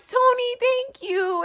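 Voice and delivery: high, slow heartfelt thanks; grateful thanks. Real, not acted. Vlogger to friend Tony, heartfelt thanks.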